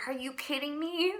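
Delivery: high, disbelieving protest; incredulous. Retold or imitated. Arguing back at God, high incredulous protest. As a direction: toss it up in stunned disbelief.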